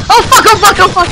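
high, loud string of alarm; frantic yelling under attack; panicked shouting. Real, not acted. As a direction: swear repeatedly in a sudden panic.

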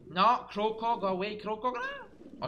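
high, urgent plea ending in a squeal; alarmed shooing. Real, not acted. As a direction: shoo it off in rising panic. Crocodile attacks in the game.

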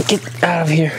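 hushed whisper to himself. Real, not acted. Alone in the bath, whispering to himself. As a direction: breathe it out under the breath.

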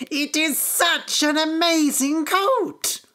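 high, slow storybook amazement; amazed farmer's wife. A narrator's reading. Farmer's wife marvels at the future-telling coat, excited. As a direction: gush in wonder, drawing the words out.

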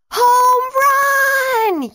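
very high, loud joyful yell; excited yell. Acted. Teammates celebrating Grace's home run, yelling with joy. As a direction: cheer it to the whole field. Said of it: "yelled her teammates".

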